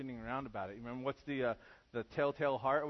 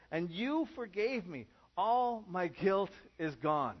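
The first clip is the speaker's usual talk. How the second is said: raised, fervent reading of the psalm; scripture reading; 5 semitones higher than usual. Acted. Pastor reading the psalm to his congregation, fervent and raised. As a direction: read the verses with rising joy. Reads Psalm thirty-two aloud.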